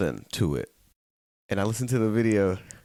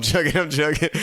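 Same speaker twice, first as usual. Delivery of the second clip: laughing; 7 semitones higher, 4 dB louder than usual. Real, not acted. Backpedals with a laugh.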